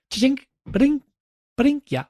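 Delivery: high chiming ba-ding; device chime. Retold or imitated. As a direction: ring out like a chime.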